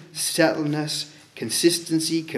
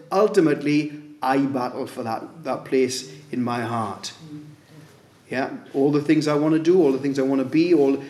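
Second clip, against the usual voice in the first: ordinary talk, nothing marked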